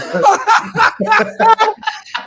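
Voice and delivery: high, sustained burst of laughter; real laugh. Real, not acted. Guest cracking up after telling the neighbourhood joke, real laugh.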